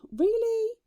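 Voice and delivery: high, loud mock disbelief; incredulous. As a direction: squeak it out in exaggerated doubt. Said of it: "I'm like".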